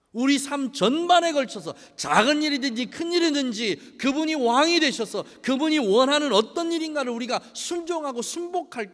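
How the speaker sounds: loud, high, impassioned sermon delivery; rising pulpit fervour; full-force preaching. Acted. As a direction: preach it out to the congregation. Preaching to congregation.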